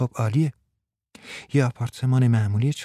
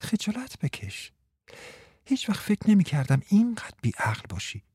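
higher, softer teasing scold; mock scolding; 8 semitones higher, 5 dB quieter than usual. Martha teasing Frans with a softer, higher mock scolding. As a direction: scold him playfully. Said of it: "تکرار کرد".

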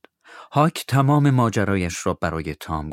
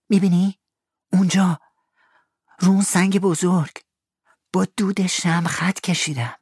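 put-on higher eager boy; Tom's boyish voice; 10 semitones higher than usual. Tom asks Huck to help find the money, eager. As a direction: sound young and keen.

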